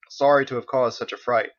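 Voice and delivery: ordinary delivery, nothing marked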